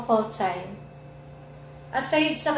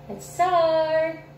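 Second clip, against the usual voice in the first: slow, stretched and higher; drawn-out cheer; 6 semitones higher, 4 dB louder than usual. Acted. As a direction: stretch the start out. Repeated opener, drawn out.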